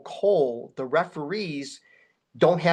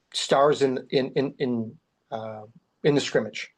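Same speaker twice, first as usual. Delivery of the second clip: normal talking voice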